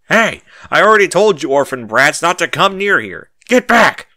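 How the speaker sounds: loud, high furious shout; angry yell. A narrator's reading. Merchant yelling at the orphan thieves, furious. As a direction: bark them off the stall. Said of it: "he yells".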